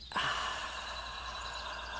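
long, soft, voiceless exhale; breathing out. Shown as a demonstration. Guide leading each breath out, soft audible exhale. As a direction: release the breath slowly in a quiet sigh. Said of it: "and out".

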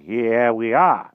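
put-on slow, deliberate old wizard. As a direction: land it slowly like a pleased magician. Merlin arriving after the spell.

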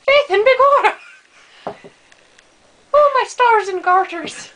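loud mock Irish exclamations; hammed-up Irish. Friend hams up a loud Irish leprechaun, mock Irish shouts. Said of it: "I'm Irish".

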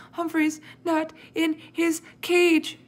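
high, slow, broken-up acted sobbing; between sobs. A narrator's reading. Sophie tells her parents of the loss, sobbing. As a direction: force it out word by word, as if sobbing. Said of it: "she said between sobs".

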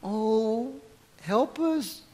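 small, soft mock plea; softly hammed-up helplessness; mock helpless plea. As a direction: imitate someone cowering in a corner. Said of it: "over in the corner going".

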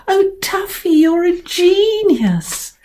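high, gushing praise; delighted girls. Acted. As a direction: gush like a thrilled little girl. Girls praising the clever cat.

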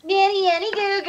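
put-on whiny kid taunt. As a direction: whine it like a bratty kid. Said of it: "I can't get a whiny voice".

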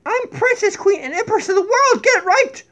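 very high, loud, laughing royal demand; spoiled queen insisting on her title; bossy queen. Acted. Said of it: "The queen said".

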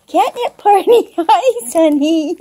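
delighted giggly catnip cheer; laughing. Real, not acted. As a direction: giggle it out to the cats. Repeating phrase, laughing.

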